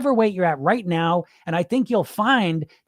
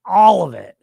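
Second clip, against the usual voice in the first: louder tempting inner voice; a louder inner voice urging eat all of it; 6 dB louder than usual. Retold or imitated. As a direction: play the voice in your head that pushes you to eat all of it. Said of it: "the voice pops in and says".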